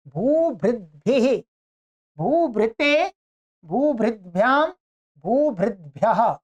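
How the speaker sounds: slow, sing-song chant of each declension form; slow recitation drill. Shown as a demonstration. Teacher chanting Sanskrit noun forms slowly for the class. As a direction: recite every form slowly for students to repeat. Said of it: "रूपों का मैं आपको उच्चारण करा देता हूं".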